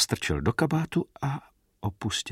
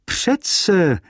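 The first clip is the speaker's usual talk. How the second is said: sudden bright recall, higher and louder; "Však přece" called out eagerly; lively exclamation; 9 semitones higher, 5 dB louder than usual. A narrator's reading. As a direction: burst out as the memory comes back. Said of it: "zvolal po chvíli živě".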